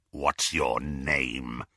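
low slow snarl. Acted. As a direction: snarl it with menace. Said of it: "snarled Greyback".

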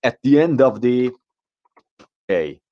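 "At the end of the day" said very slowly, each word separate; drawn-out teaching read of "At the end of the day"; a teacher modelling the right phrase "At the end of the day" slowly; correct version. Shown as a demonstration. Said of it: "یاد آو میافتید".

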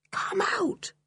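a soft unvoiced echoing whisper. A narrator's reading. The echoes whisper back Julian's words, whispered. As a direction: breathe it like a far echo. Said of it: "whispered the echoes".

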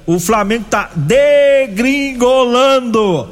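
high, slow, drawn-out announcer voice; a hint of an old radio announcer; Henrique Miranda. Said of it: "como dizia o saudoso Henrique Miranda".

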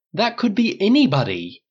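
high, loud protest; shocked cry of disbelief; indignant outburst. Acted. Dale protests to Paul, loud and high.

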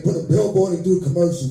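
normal talking voice

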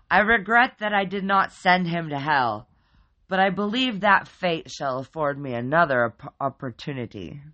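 loud booming formal creature; booming demon bear. A narrator's reading. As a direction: boom it out solemnly. Giant teddy bear demon boomed.

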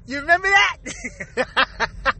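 slow, stretched, rising you remember that?; drawn-out repeat. Pretend. Teasing listeners about an old reference, slow and stretched. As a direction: stretch remember out slowly and let it rise.

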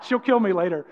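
normal talking voice

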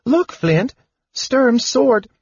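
very high, eager kender voice; wide-eyed wonder. A narrator's reading. Kender showing dwarf the sword, wide-eyed wonder. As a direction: point it out excitedly, high-pitched. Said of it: "the wide-eyed kender said".

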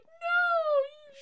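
high, loud drawn-out wail; hammed-up falsetto scream of refusal; mock scream. Retelling a woman's failed escape in the film, mock scream.

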